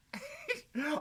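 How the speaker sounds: a burst of high, giddy laughter. Acted. Scrooge giddy on Christmas morning, laughing high and free. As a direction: let a light, high laugh escape.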